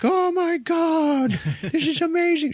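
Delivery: very high, very slow, loud gushing with a laugh; delighted gushing. Acted. Diana tasting ice cream for the first time, gushing very high and slow. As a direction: gasp in delight at a first taste. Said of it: "is like".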